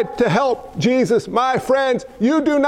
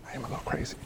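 breathy whispered confession; whispered joke to the congregation; whispered aside; 17 dB quieter, much faster than usual. Real, not acted. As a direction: drop to a whisper, joking. Self-deprecating aside.

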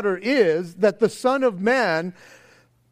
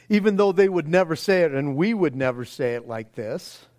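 normal talking voice

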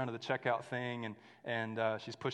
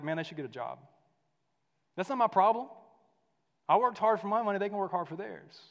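a mildly louder, scornful mocking of selfish believers; 8 semitones higher, 7 dB louder than usual. Preacher mocking Christians who dismiss the poor, scornful parody. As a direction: sneer it like a smug churchgoer, half-parodied. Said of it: "heard Christians talk like that".

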